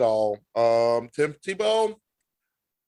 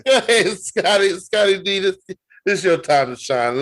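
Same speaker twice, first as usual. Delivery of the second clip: hype call; 5 semitones higher, 6 dB louder than usual. Real, not acted. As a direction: pump him up by name. Hyping co-host for his turn.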